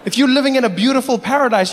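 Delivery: ordinary talk, nothing marked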